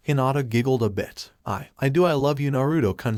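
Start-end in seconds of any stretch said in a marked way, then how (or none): none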